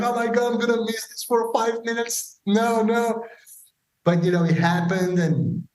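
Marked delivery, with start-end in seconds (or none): 0.0-3.2 much higher, frantic inner panic. Retold or imitated. [name] panicking that he'll miss the show by minutes. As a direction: squeal it like you might miss the show. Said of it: "I'm like".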